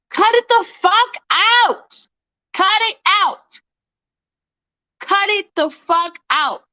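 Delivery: fed-up yell to knock it off; angry outburst. Real, not acted. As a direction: tell them off hard. Angry at fakes pretending.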